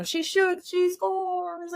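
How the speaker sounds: high, playful announcer cry; play-by-play to yourself at the desk; mock sports call. At her desk, pretending to score, mock commentator call. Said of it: "you're like".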